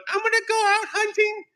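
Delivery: put-on very high, far-off child voice; a hammed-up little boy; imitating the movie kid; squeaky kid voice. Voicing the kid in dialogue.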